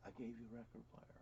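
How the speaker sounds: very soft, low, quick murmur; imitating a secretive hush; hushed retelling. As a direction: say it under your breath, as if buying silence. Imitates niece's giver hushing him.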